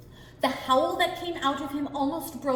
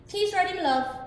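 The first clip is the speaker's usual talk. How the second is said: loving wife; 7 semitones higher, 5 dB louder than usual. Acted. Wife calling husband.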